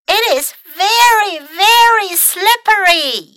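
high yell with long stretched words. A narrator's reading. Mac complains to his friends from uphill, yelling. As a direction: wail it out loudly, drawing out each word. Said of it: "yelled Mac".

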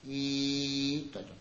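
「いいって」をゆっくり小さく引き伸ばす; 扉のきしみのように「いいって」を低く長く; eerie creak. Shown as a demonstration. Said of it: "いいって開いちゃった". (扉がきしむように、ゆっくり小声で)

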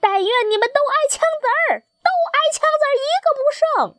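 very high, rapid squawking rage; furious goose shout. A narrator's reading. Enraged tame geese cursing the wild geese, shrieking fury. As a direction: rattle out the curse fast and high. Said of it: "狂怒地喊道".

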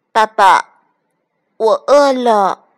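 loud, drawn-out duckling plea; hungry duckling. A narrator's reading. Duckling to papa fox, pleading hungrily. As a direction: whine for food like a baby duck.

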